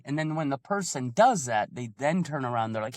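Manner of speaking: ordinary talk, nothing marked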